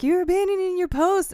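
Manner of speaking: hammed-up accusing shout; imitating Willem Dafoe. A fan imitating Dafoe's line for a friend, loud mock shout. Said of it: "Willem Dafoe's like".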